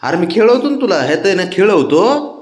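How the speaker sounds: high coaxing, slowing at the end. Real, not acted. Owner bargaining with pet, high sing-song coo.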